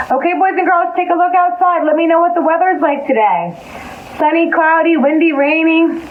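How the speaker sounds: put-on high, bright singsong for little kids; cheerful preschool teacher greeting; kindergarten teacher voice. Greeting children, pitch raised.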